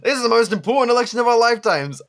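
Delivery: high, loud, fast hype voice; mock announcer. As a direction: ham up the campaign pitch. Mocking election hype.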